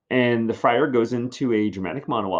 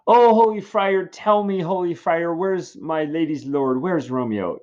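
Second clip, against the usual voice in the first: higher, lightly voiced worried nurse; fretful nurse; 7 semitones higher than usual. The nurse begging the friar for Romeo, mildly higher. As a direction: plead like a flustered old servant.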